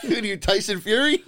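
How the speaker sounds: high, laughing tease. Real, not acted. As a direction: laugh while you tease him. Laughing at friend's outburst.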